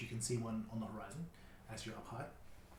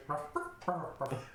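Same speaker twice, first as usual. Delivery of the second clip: string of imitated dog barks. Dog answering the droid, imitated barking. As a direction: bark back like a dog. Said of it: "The dog turns back and goes".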